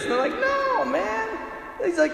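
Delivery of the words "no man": put-on high, dismissive teenage voice; cocky kids. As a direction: brush it off like a cocky kid.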